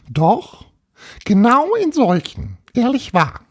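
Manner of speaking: very high-pitched tiny ghost voice; little castle ghost. Acted. Little ghost tells Paul where it lives, high ghost voice. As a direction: squeak it out as a small, self-assured ghost.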